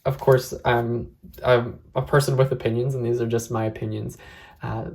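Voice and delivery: ordinary delivery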